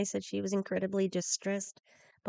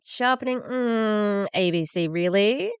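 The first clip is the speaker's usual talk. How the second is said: higher, louder scoffing repeat; sarcastic echo; 4 semitones higher, 8 dB louder, slower than usual. Real, not acted. Commentator scoffing at the news anchor's word, sarcastic.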